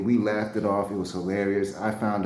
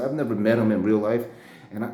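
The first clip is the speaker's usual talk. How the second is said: normal talking voice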